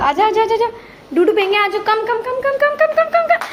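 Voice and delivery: high, climbing sing-song calls. Real, not acted. Calling toddler over in baby talk.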